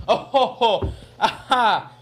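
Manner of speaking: chuckling at own joke; laughing. Real, not acted. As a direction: laugh through the words.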